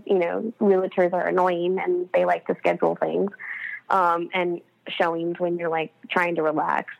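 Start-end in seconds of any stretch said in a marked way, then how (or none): none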